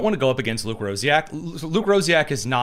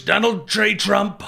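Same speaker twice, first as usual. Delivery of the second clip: higher, put-on politician impression; Donald Trump; 6 semitones higher, slower than usual. Host listing his accents, brief Trump impression. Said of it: "the accents that I do".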